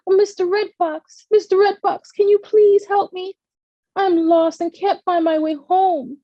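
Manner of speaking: put-on a lost child begging for help; pleading. A narrator's reading. Lost girl begs fox.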